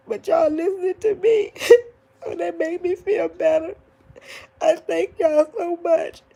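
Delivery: real tearful crying, pitched high; high, tearful, teary gratitude. Real, not acted. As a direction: voice breaking into tears while thanking them. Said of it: "Y'all ain't gotta be listening to me cry".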